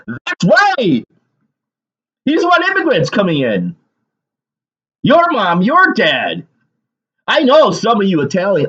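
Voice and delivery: high, exasperated outburst; angry rant. Real, not acted. Heated rant against politician and voters.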